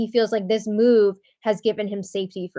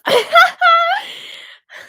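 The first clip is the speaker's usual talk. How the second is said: laugh; 7 dB louder than usual. Real, not acted. Laughing at Vivek's hug line.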